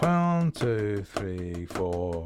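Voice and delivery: rhythmic count-in. Shown as a demonstration. Drummer counting a bar of flams, rhythmic counting. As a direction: count the bar, falling away after the first beats. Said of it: "a whole bar of alternating flams".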